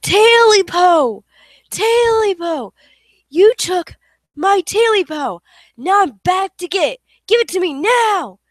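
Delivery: loud menacing ghost wail; deafening ghost cry. Acted. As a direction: roar it so it fills the house. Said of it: "the voice was so loud it was deafening".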